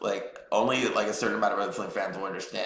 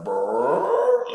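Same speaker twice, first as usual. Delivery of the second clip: growly brrr; 3 semitones higher, 6 dB louder than usual. Retold or imitated. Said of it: "like that".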